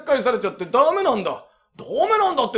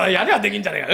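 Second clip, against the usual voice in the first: usual speaking voice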